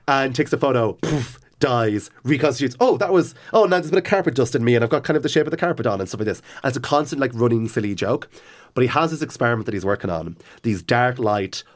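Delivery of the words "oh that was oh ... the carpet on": a lightly voiced fussy vampire, higher and fast. Retelling Otto's running joke to a friend, lightly voiced fussy vampire. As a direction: grumble about carpet dust in a mild put-on vampire voice.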